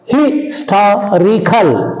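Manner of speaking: Historical said very slowly, TOR stressed. Shown as a demonstration. Teacher showing the stressed syllable, Historical slow with TOR stressed. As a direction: stretch out Historical, leaning on the TOR. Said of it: "on the anti-penultimate syllable".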